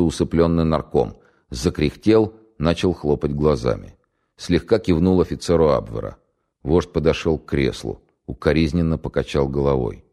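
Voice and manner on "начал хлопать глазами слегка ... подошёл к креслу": ordinary delivery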